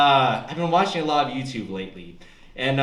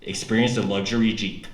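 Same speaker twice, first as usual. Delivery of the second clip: car ad voice; 7 semitones lower than usual. Pretend. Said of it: "let me paint a picture for you".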